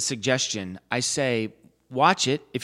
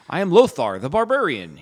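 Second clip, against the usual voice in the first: Lothar voice; 5 semitones higher, 6 dB louder than usual. Retold or imitated. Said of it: "the whole thing in that voice".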